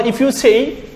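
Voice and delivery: ordinary talk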